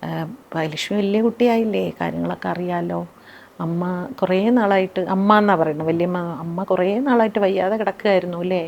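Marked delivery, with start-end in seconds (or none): 0.1-5.0 softer, hushed confiding tone; softly, in private. Retold or imitated. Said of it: "പതുക്കെ എൻ്റെ സ്വകാര്യത്തിൽ പറഞ്ഞു". 6.4-8.6 softer, hushed confiding tone; softly, in private. Retold or imitated. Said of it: "പതുക്കെ എൻ്റെ സ്വകാര്യത്തിൽ പറഞ്ഞു".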